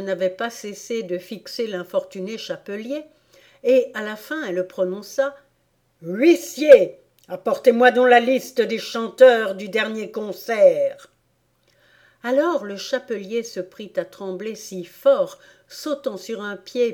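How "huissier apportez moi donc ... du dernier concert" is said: loud imperious order; loud royal summons; commanding Queen. Acted. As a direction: call out to the usher.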